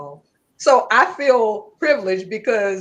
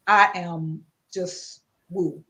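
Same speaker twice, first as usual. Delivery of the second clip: normal talking voice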